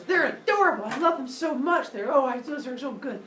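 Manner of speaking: high, gushing, adoring squeal; Mother Earth. Acted. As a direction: gush over adorable newborns in a high voice. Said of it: "Mother Earth declares".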